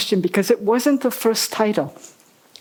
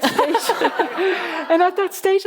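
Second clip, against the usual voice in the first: laughing; 9 semitones higher, slower than usual. Real, not acted. Laughing while recalling naive hopes.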